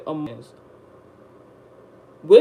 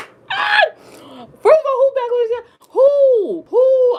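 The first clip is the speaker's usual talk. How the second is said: much higher and louder incredulous shout; outraged shout; 10 dB louder, much slower than usual. Retold or imitated. Outraged at his mother's accusation, high incredulous shout. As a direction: blast it in disbelief.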